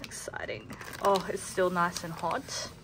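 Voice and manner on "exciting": whispered. Real, not acted. Whispered aside about food.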